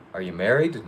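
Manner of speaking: ordinary delivery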